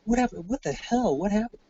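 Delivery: high, repeated baffled questions; retold exasperated bewilderment; incredulous demand. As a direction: fire off questions in rising disbelief. Sergeant baffled by more limping soldiers.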